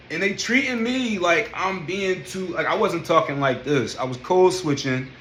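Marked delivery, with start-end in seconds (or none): none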